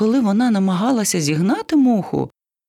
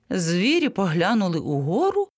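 plain reading voice

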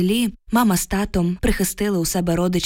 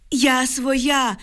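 higher-pitched yell, contained in volume; hollering; 6 semitones higher than usual. A narrator's reading. Exhausted woman stumbling toward armed soldiers, high contained holler. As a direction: holler to be recognised, as in narration. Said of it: "горланила якомога голосніше".

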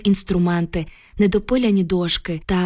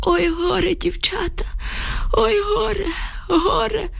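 put-on very high, drawn-out old man's wail; wailing lament; 12 semitones higher, slower than usual. A narrator's reading. Old man laments to the two women, wailing. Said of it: "заголосив він".

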